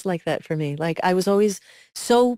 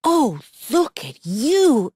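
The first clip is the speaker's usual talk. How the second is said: slow, tender, louder admiration; loving old man; 5 semitones higher, 4 dB louder, much slower than usual. Retold or imitated. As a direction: drawl it out lovingly. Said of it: "He was like".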